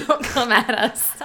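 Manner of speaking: chuckling, drawn-out don't come at us; laughing mock warning. Real, not acted. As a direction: laugh through a fake threat to the brand.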